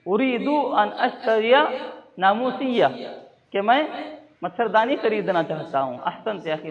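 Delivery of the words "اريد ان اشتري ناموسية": drawn-out, careful teaching delivery; slow model repeat. Shown as a demonstration. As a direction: spell it out slowly for learners. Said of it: "model repeat".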